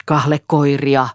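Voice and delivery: slow, drawn-out shouted word; understated shout. A narrator's reading. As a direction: call it out loudly, stretching the word. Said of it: "huusi Eero". (venytetty, hillitty huuto)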